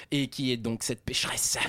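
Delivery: low, slow priestly question; a confessor probing gravely; solemn priest. Acted. Priest asks the sinner who the woman was, low and slow.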